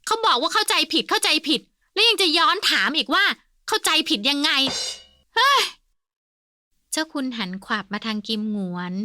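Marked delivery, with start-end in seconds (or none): 0.1-5.6 high, loud mocking cry. A narrator's reading. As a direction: echo him loudly to stir trouble. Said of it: "อาเสียร้องลั่น". (อาเสียร้องลั่นล้อเลียน)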